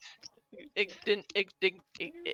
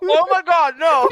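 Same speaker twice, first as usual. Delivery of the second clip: loud laughing shout; shocked outcry; 14 semitones higher, 18 dB louder, slower than usual. Real, not acted. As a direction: yell out in shocked delight. Reacts to failed wisdom save.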